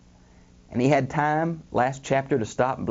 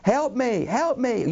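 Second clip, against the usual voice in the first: high mock cry for help; imitated scream for help; 9 semitones higher, slower than usual. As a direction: call out for help in a raised voice, no real scream. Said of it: "someone starts screaming".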